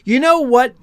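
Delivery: acted loud remark, pitched well up; rather loudly. A narrator's reading. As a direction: say it loud enough to be overheard. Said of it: "rather loudly".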